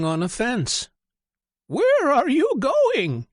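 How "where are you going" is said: high, loud turkey voice; calling turkey. Acted. Turkey calls down to the chick. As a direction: holler it from the fence as a turkey. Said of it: "he asked Chicken Little".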